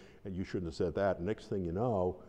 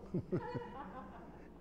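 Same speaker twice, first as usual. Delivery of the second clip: soft, high chuckle; real wheezy giggle at the story; amused laugh; 11 semitones higher, 9 dB quieter than usual. Real, not acted. Preacher amused by his own tale, soft laughing.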